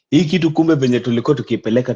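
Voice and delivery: usual speaking voice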